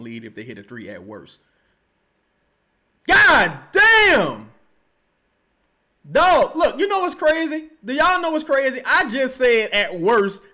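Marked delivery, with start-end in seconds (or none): 3.1-4.5 high, drawn-out yell of disbelief; real courtside-fan outburst; shocked shout. Real, not acted. Shocked reaction to a foul. 6.1-10.4 loud, high-pitched rant; exasperated fan raising the voice; worked-up disbelief. Real, not acted. Ranting at the foul just seen.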